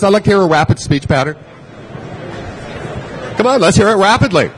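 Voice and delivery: fast rapid delivery; rapid speech. Shown as a demonstration. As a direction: rattle it off quickly. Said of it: "Talk to the person next to you rapidly".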